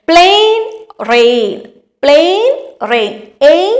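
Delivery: plain, rain sounded out with the ending എയ് drawn out; rhyme demonstration. Shown as a demonstration. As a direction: bounce the rhyme for the class. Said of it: "എയ് എന്ന് തന്നെ പറഞ്ഞു".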